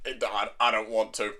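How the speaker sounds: slow, low, quiet refusal; mock reluctant grumble, dragged out. Demon Lord answers the begging boy, reluctant.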